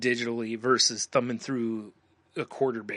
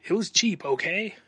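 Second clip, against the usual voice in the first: higher, put-on defensive whine; mock defensive excuse; 7 semitones higher, 3 dB louder than usual. Teasing friend about a cheap album buy, mock defensive. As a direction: make the excuse like you're caught out.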